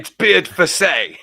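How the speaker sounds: slow, loud Aussie twang on "It's beard for say"; mock Australian accent. Mocking a footballer's name in an Australian accent. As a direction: drawl it out like a cheeky Aussie mate. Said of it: "sounds good when you put an Aussie twang to it".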